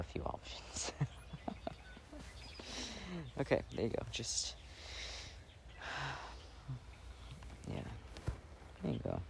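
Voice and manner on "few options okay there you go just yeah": soft whisper, almost no voice. Acted. Girlfriend confesses to her boyfriend in a tender whisper. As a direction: let it slip out as a breathy secret. Cozy girlfriend roleplay aside.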